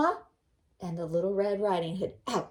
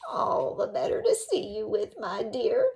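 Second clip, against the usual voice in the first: sly wolf voice; 7 semitones higher, 3 dB louder than usual. Acted. Wolf answering Red.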